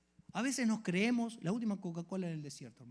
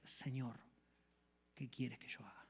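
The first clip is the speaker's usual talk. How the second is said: softer, whispered surrender; humble whisper; 4 semitones lower, 7 dB quieter than usual. Acted. Believer meeting Christ, whispering surrender. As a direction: hush it out, humbly. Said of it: "lo primero que sale de ti es".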